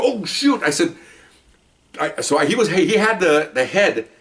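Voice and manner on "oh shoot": dismayed. Retold or imitated. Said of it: "I go".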